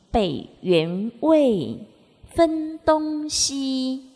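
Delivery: very slow, drawn-out syllables; classic verse recitation. Acted. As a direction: recite each three-word line slowly and deliberately, with pauses between. Recites the Three Character Classic. (缓慢拉长地诵读《三字经》)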